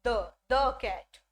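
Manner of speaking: "the" as a short "thuh", slowly, then "the cat"; drawn-out model of "the cat" with a schwa; teacher slowly modelling "the cat"; slow demo of "the". Shown as a demonstration. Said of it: "जिसका pronunciation है वो रहेगा".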